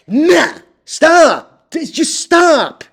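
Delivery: fed-up shout at the bag holders; real frustration boiling over; exasperated outburst. Real, not acted. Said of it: "you're gonna make me angry".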